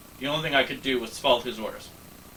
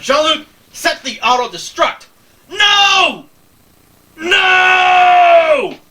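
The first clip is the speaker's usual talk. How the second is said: loud, very high, desperate yell; shouting; 14 dB louder, much slower than usual. Acted. Narrator shouting at Picard to set the auto-destruct. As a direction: scream the order and the refusals.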